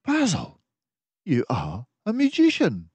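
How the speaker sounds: a friendly doctor mouse musing aloud; Dawson the narrator mouse. Acted. Dawson marvels.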